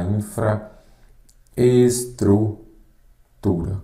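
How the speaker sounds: "infraestrutura" broken up slowly, with pauses between parts; split into syllables. Shown as a demonstration. Teacher confirming a hyphenless spelling to students, slowly syllable by syllable. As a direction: sound out "infraestrutura" piece by piece.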